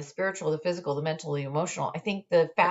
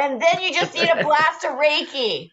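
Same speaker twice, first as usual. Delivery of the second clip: laughing; 10 semitones higher, 7 dB louder than usual. Real, not acted. Joking about a Reiki blast.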